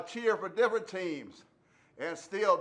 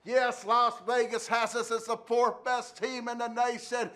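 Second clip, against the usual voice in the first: ordinary talk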